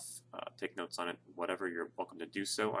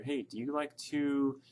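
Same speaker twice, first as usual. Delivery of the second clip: student asking teacher; 7 semitones higher, slower than usual. Acted. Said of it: "you're asking your teacher".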